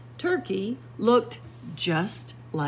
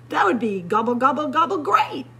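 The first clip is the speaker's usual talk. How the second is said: excited gobbling; 5 semitones higher, 6 dB louder, much faster than usual. Acted. Turkey delighted by offer of help.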